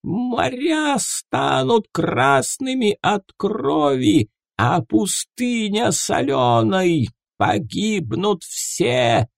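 high, slow wailing oracle cry; screaming prophecy. Acted. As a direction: cry out the doom in trance. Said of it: "вновь перешла на крик".

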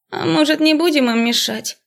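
высокий меланхоличный девичий голос; предложи мечтательно, без спешки; меланхолично. A narrator's reading. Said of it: "Чей-то меланхоличный голос". (меланхоличный голосок среди общего шума)

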